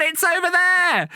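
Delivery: put-on very high, slow childlike call; excited kids. Kids in the panto crowd calling out, very high. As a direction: shout it out like a thrilled child. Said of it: "the kids will be like".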